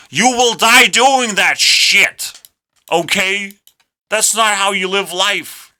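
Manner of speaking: high, loud emphatic rant; heated shouting. Real, not acted. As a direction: yell the warning, drawing out the words. Rant peaks, warning the listener.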